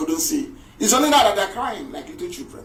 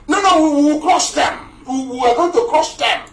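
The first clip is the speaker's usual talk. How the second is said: high, louder, blustering refusal; mock defiance; 8 semitones higher, 4 dB louder than usual. Speaker mimics army boasters rejecting dialogue, mock defiance. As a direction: scoff out their stubborn boast, pitched up high.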